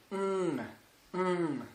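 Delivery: a soft, held NG hum for "Hum"; nasal ng. Shown as a demonstration. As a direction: hum "Hum" through the nose, softly. (« Hum » : un ng nasal, doux et tenu)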